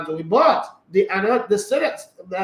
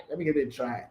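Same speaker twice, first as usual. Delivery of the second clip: repeated much softer, to himself; soft aside; 6 semitones lower, 9 dB quieter, faster than usual. Shown as a demonstration. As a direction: murmur it again under the breath. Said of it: "So let me give it a try".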